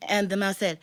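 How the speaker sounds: ordinary delivery, nothing marked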